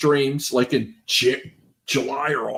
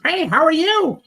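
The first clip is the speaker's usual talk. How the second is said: mock high-pitched greeting, much higher; funny high voice; 14 semitones higher, 3 dB louder than usual. Imitating the guest's funny voice on stream. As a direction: squeak it out like the odd stream guest. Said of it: "The voice was funny".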